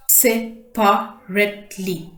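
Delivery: "Separately" slowly as se, pa, rate, ly; four separate beats on "Separately"; split into syllables. Shown as a demonstration. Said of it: "four syllables".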